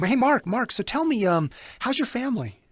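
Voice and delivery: high, scripted sales pitch; telemarketer voice. Shown as a demonstration. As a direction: fake cheerful cold caller. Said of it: "you sound like a telemarketer".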